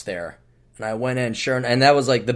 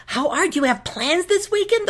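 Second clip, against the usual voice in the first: bright scripted question, stretched out; scripted teller chat; 13 semitones higher than usual. Retold or imitated. Teller making scripted small talk with a customer, high and chirpy.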